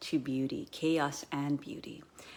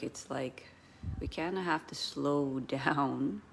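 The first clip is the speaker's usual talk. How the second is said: ordinary talk, nothing marked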